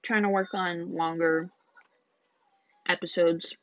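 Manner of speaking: eating while talking; mouth full. Real, not acted. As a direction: talk through a mouthful. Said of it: "sorry, I'm eating".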